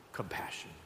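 whispered, low; soft breath of a word after a pause; hushed reverence. Real, not acted. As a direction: let the word drop to a whisper. Hushed climax of a quoted verse.

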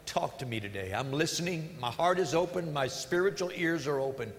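soft, earnest opening prayer; hushed pastoral prayer. Real, not acted. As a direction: pray it quietly and sincerely.

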